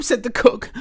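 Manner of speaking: lively, loud read-aloud storytelling; animated picture-book reading; story narration. A narrator's reading. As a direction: read it big and bouncy for kids.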